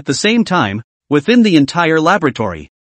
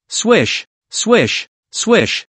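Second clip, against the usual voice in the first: voiced swish sound effect; 6 semitones higher, much slower than usual. A narrator's reading. Narrator voices swishing orbs.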